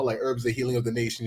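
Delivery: ordinary talk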